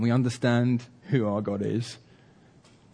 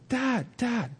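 high mock shout at contained volume; high, spaced-out calls of a trusting son; boy shouting; 8 semitones higher than usual. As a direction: imitate a boy calling out to his dad. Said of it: "just shouts".

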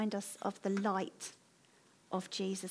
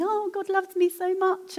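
high, louder imitation of other worshippers; imitating gushing believers; 10 semitones higher, 6 dB louder than usual. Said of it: "I'd hear other people going".

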